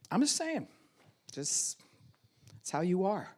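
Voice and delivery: high, airy brush-off; dismissive retort. Retold or imitated. Gaslighter dismissing partner, airy brush-off. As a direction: shrug it off as if innocent.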